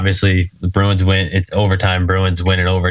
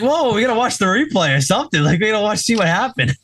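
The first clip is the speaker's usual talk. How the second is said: much higher, hurried pleading; mock urgent protest; 12 semitones higher, faster than usual. Friends beg to watch the replay, urgent protest. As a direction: protest in a high, excited voice. Acting out group protesting bedtime.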